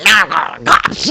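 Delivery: slow, high whiny threat; mock coworker threat. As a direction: drawl the threat smugly.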